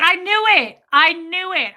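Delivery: loud, high crowing; triumphant outburst. Retold or imitated. Patient hearing the diagnosis from therapist, loud triumph. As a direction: burst out with vindication. Her triumph in retold session.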